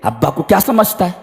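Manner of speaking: mock insolent youth mouthing off at parents; mocking rude youth. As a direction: sneer it like a spoilt youngster. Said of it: "आज भी कहते हैं".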